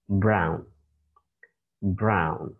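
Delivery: "brown" said as /braʊn/ with a falling end; teacher demo of "brown"; model pronunciation. Shown as a demonstration. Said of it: "you should read it".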